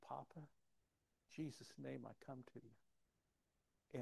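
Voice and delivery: hushed, slow imitation of prayer; very soft, slow, intimate plea. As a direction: curl up small and pray quietly to a father. Imitates her praying in God's lap.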